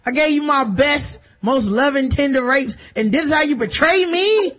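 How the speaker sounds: high, building to a hammed-up threat; mock furious. Betrayed villain yelling at woman.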